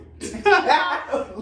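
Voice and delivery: loud amused laugh at herself; burst of laughter. Real, not acted. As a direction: laugh out at the memory. Laughing at her own denial.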